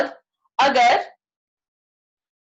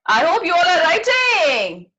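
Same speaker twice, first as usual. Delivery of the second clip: usual speaking voice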